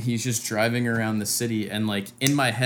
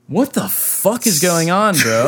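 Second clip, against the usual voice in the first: higher, louder, slowed freaked-out cry; panicked disbelief; 5 semitones higher, 5 dB louder, slower than usual. Retold or imitated. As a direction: stretch it out in mock alarm. Trapped in wrong car.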